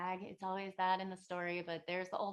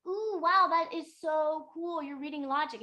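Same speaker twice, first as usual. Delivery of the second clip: delighted gushing; 7 semitones higher, 4 dB louder than usual. Retold or imitated. Old hag marvelling at the student reading logic, delighted. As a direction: gush in exaggerated awe, imitating. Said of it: "very happy".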